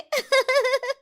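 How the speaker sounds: very high, bubbly giggle. Acted. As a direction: giggle at your own tease. Granddaughter laughs at own tease. (ऊँची खिलखिलाहट)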